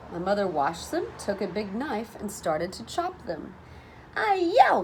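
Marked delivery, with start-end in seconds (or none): 4.2-4.8 strained exclamation. A narrator's reading. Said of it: "she said when she cut them".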